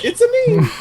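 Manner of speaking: high, cartoon Italian voice; mock Italian accent. Friends riff on a botched impression, mock Italian accent. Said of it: "It's Italian".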